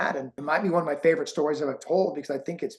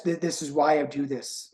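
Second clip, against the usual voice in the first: ordinary talk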